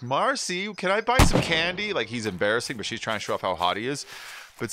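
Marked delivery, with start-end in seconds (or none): 0.0-1.9 put-on childish Jefferson. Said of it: "And Jefferson's, you know, like".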